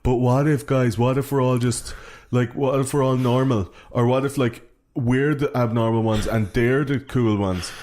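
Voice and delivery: hammed-up fretting preppy; imitating Charlie. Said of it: "used to say the whole time".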